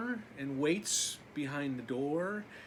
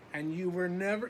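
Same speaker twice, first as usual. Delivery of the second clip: plain reading voice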